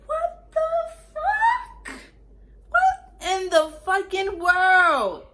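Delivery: very high, shrill, slow screaming disbelief; furious shout. Retold or imitated. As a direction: scream it like she just blocked you in. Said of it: "I'm so mad".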